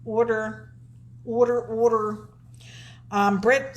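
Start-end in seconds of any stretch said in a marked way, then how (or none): 0.0-0.6 Southern accent. Shown as a demonstration. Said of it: "I love your southern accent as you say order". 1.2-2.3 Southern accent. Shown as a demonstration. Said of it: "I love your southern accent as you say order".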